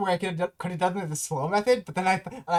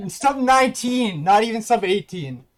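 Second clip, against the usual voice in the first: loud, slow, thrilled announcement; excited shout; 6 semitones higher, 8 dB louder, slower than usual. Real, not acted. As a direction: shout the time out in triumph. Oh, I said that on recording.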